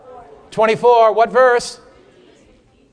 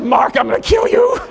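mock threat; 6 semitones higher, 4 dB louder than usual. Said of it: "She said".

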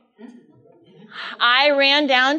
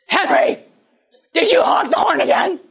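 exasperated shout; 9 semitones higher, 7 dB louder than usual. Retold or imitated. Mother scolds father.

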